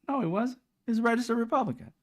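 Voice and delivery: insistent correction. Retold or imitated. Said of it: "I'm like".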